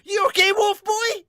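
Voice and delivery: loud, very high goofy yell; Sawamura. Acted. Host showing the character is still goofy, rowdy loud shout. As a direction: holler it like a rowdy anime goofball. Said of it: "that's still very much Sawamura".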